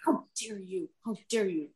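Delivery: low, quiet mock outrage at yourself; mock self-scolding. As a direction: scold yourself in the mirror, hushed. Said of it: "I'm like".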